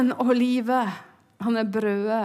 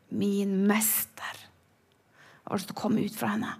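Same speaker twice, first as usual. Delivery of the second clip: awed whisper; 7 dB quieter than usual. Retold or imitated. Mary recognising her master, whispered. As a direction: breathe it in awe.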